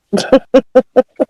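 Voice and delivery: high staccato giggle; real amused laugh. Real, not acted. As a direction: burst out giggling at the joke. Laughs at the dead cat line.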